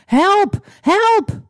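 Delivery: calling for help. Acted. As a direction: shriek it like she's in danger. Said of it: "ze begon heel hard om hulp te roepen".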